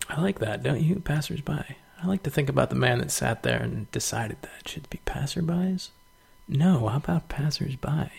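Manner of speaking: hushed conspiratorial whisper. Real, not acted. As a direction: whisper it like a secret. Conspiratorial whisper about wordsmith.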